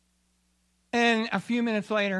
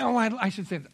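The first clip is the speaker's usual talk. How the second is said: ordinary delivery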